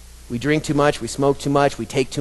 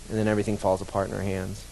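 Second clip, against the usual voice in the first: quiet grim aside; 6 semitones lower, 10 dB quieter, faster than usual. Real, not acted. Grim consequence, quiet fast.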